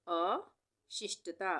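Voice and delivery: अशिष्टता very slow, pause after अ; broken-up dictation. Shown as a demonstration. Teacher dictating a spelling word, अशिष्टता split after अ. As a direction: say अ alone, then शिष्टता.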